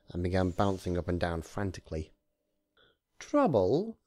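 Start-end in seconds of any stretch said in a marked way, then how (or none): 3.2-4.0 high rising question; high questioning echo; incredulous repeat. A narrator's reading. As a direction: echo the word in mock surprise. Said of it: "Discord repeated".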